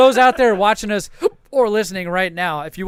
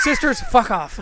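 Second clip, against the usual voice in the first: telling off; 5 semitones higher, slower than usual. Shown as a demonstration. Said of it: "I just did it".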